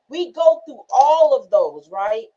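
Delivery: normal talking voice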